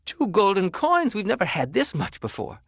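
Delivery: can't believe the money; excited wife. Acted. Wife celebrates the two gold coins, high and thrilled.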